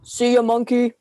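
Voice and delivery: put-on a father calling out a goodbye to his kid; dad voice. Dad says goodbye to daughter.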